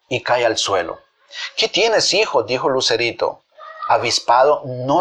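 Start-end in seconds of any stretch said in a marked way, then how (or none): 1.6-2.4 high, worried question; acted concern for a fallen son; alarmed mother. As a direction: ask in sudden motherly alarm, pitch raised.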